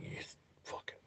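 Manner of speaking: whispered swearing; barely voiced, retold exasperation; muttered curse. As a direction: curse almost silently under your breath. Muttered reaction to woman leaving.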